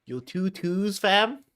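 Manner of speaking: high, far Toronto slang voice; toronto slang accent. Retold or imitated. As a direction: put on a Toronto street accent. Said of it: "That's a Toronto accent".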